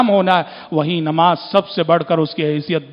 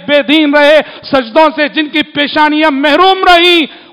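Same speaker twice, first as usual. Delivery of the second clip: louder and higher, fiery preacher's cry; preaching at full force; 7 semitones higher, 7 dB louder than usual. Acted. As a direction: thunder it out to the congregation. Sermon describing Judgement Day command.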